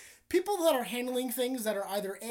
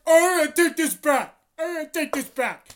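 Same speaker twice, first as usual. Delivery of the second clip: loud, insistent complaining; loudly insisting he take the burger back; mock complaint; 4 semitones higher, 11 dB louder than usual. Friend demanding a new burger, loud and insistent. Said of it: "he was like".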